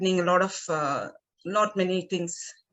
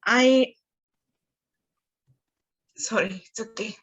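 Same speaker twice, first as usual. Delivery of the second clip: choking up; 3 dB louder than usual. Real, not acted. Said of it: "I may get emotional".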